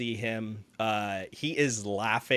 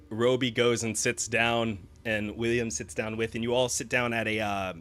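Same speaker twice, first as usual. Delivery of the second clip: ordinary delivery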